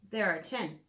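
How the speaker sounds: ordinary talk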